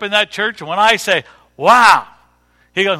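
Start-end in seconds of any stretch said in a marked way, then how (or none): 1.6-2.1 loud, impressed exclamation; emphatic, full-voiced wow; amazed wow. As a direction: burst out with admiration. Acting own amazement at famous preacher.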